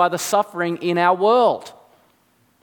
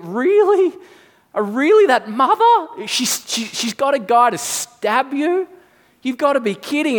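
horrified disbelief; 7 semitones higher than usual. Pretend. Stranger hearing a child's story, horrified disbelief. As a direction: gasp it out, shocked a mother would do that. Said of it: "you're horrified because you think".

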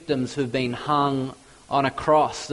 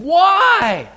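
much higher, louder, a desperate cry; pleading cry; 13 semitones higher, 7 dB louder than usual. Retold or imitated. Pilate cries out to the mob in a high, loud plea. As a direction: burst out with the question. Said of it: "he spoke to them".

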